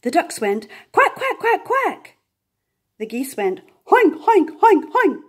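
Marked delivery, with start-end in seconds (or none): 0.9-2.2 much higher, squeaky quacking; squeaky duck quacks. A narrator's reading. Ducks quacking excitedly. 4.2-5.2 much higher and louder honking; very loud goose hoink. A narrator's reading. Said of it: "which means very loudly".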